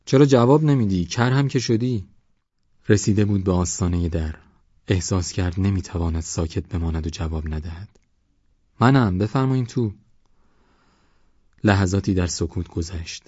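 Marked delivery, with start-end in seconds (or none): 0.0-2.1 high, loud scolding shout; impatient calling. A narrator's reading. Old man shouts, gets no answer. 8.8-9.9 raised voice inviting someone in; calling back. A narrator's reading. Boy calls back from hut.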